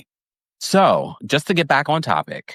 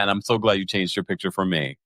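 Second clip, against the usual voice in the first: usual speaking voice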